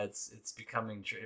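ordinary talk

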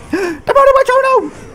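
very high gasping oh no; mock panic at the enemy card; alarmed gasp. Real, not acted. Gamer spotting the enemy witch, alarmed gasp. Opponent drops surprise card.

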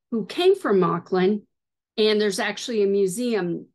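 normal talking voice

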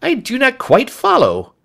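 puzzled and taken aback; startled. A narrator's reading. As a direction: react with baffled surprise. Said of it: "Lestrade looked startled".

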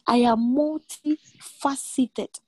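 "I am multifaceted" drawn out slowly, each word weighted; slow it right down so "multifaceted" lands; slow emphatic repeat. Shown as a demonstration. Preacher repeating God's words slowly so they sink in. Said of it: "Don't miss this".

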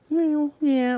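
usual speaking voice

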